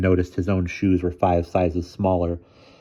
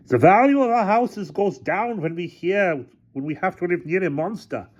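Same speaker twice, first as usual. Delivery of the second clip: high-pitched shouted complaint; shouting; 12 semitones higher than usual. A narrator's reading. Villager complains about house values, high shouting. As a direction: protest it high, like an indignant heckler. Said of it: "a man shouting".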